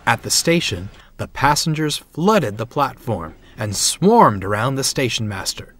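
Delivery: usual speaking voice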